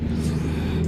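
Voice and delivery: harsh choking cough; hacking cough; coughing fit. Real, not acted. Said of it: "I don't know why I'm choking".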